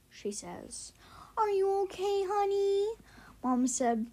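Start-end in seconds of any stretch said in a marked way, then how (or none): 1.4-3.0 concerned mom. A narrator's reading. Mom asks after the call from school, concerned and high. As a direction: ask it high and sing-song like a worried mom.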